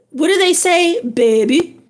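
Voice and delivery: playful Louisiana accent, teasing a viewer; a hint of Louisiana accent, greeting a viewer from Louisiana. Shown as a demonstration. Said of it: "That's my Louisiana accent".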